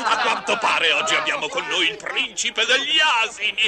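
laughing while mocking; mocking laughter. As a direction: laugh at him scornfully.

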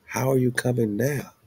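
ordinary talk, nothing marked

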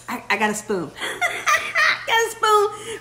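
laughing through the words, pitch jumping up; tickled, laughing mid-phrase; giggling. Real, not acted. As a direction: break into a high giggle. Giggling as she finds a spoon.

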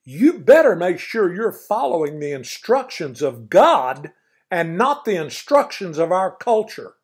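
loud stern grandpa warning; mean grandpa voice. Acted. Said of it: "my mean grandpa voice".

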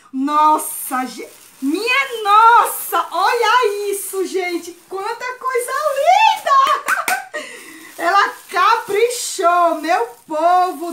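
high, loud and slow gasps of wonder, laughing; amazed delight. Real, not acted. Vlogger pulling out the handmade gifts gushes, slow, high and loud with wonder. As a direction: draw out every word, overwhelmed by the gift. Admiring the gift's contents.

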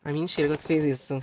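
low, quiet, quick mutter; deflated disbelief. Pretend. Host finding the gift is not as hoped, deflated. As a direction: drop to a stunned, let-down murmur. Let down after opening gift.

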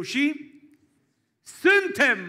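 high, loud proclamation; preaching shout. Acted. Pastor to the congregation on being God's children, triumphant cry.

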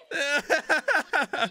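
real high cackling burst; amused, high rolling laughter. Real, not acted. Laughs at crew's plight.

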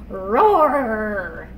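tiger roar. Pretend. Tiger sound in picture book.

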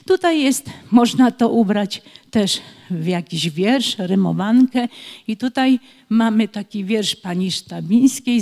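usual speaking voice

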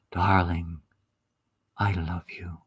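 low, breathless whisper of love; romantic whisper. Acted. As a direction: whisper it tenderly across the table. Husband whispers to wife at dinner.

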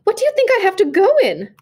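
high, loud burst of impatience; impatient outburst. A narrator's reading. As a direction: snap it out high and loud at your husband. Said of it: "burst out impatiently".

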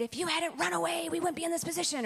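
a mom's mild mock scolding; mock yell at dog. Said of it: "I yelled at the dog".

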